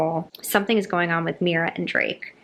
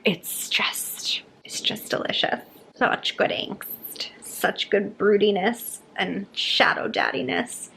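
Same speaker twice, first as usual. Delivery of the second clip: swooning whisper; slower than usual. Real, not acted. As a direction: whisper it like you're melting. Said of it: "swooning over Xaden".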